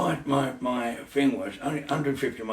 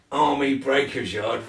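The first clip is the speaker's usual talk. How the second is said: ordinary talk, nothing marked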